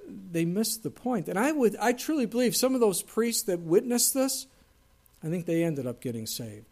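usual speaking voice

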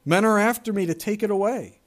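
a fugitive begging loudly for shelter; desperate plea. Acted. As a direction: beg for your life out loud. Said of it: "he cried out to the man".

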